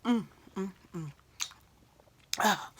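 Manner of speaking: ordinary talk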